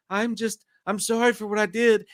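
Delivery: small mock-contrite voice; meek little bookworm. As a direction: play a timid bookworm saying sorry. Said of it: "like, bookworm, like, little".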